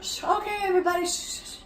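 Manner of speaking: high shushing; hushing crowd. Retold or imitated. Koji hushing his friends, high shushing. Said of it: "Koji-san is like".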